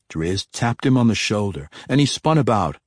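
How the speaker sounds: usual speaking voice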